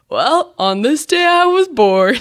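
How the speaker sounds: slow, drawn-out mock life-story narration; mock dull recital. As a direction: send up someone stiffly reciting into a phone. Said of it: "monotone voice that just sounds like no one wants to listen".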